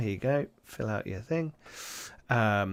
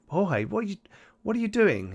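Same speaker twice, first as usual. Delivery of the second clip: startled; 10 semitones higher than usual. Acted. Innkeeper startled by Jorge.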